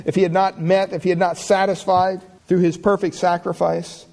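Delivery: high, driving pulpit voice, pressing each phrase; full-force preaching. Acted. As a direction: build and hammer the point home. Rising preaching toward close.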